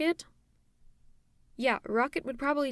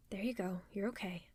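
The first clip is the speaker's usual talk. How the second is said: soothing whisper; 6 dB quieter than usual. Acted. Calming him after nightmare.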